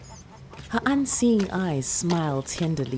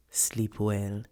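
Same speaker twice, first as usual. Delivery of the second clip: lower, softer goodnight; soft grandmother; 5 semitones lower, 6 dB quieter than usual. Acted. Grandmother wishing the child goodnight. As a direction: say goodnight tenderly.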